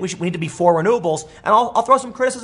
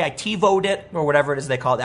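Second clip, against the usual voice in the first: usual speaking voice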